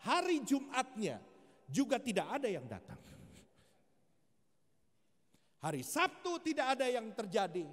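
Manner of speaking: rising preacher's emphasis, day after day; emphatic building count. Retold or imitated. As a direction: hammer each empty day home to the congregation. Counting days nobody came.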